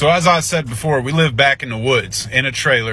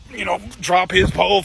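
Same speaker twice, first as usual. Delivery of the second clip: ordinary talk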